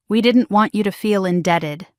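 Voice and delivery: usual speaking voice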